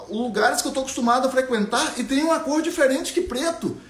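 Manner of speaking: put-on a girl who saw colour, insisting; young Ariana insisting.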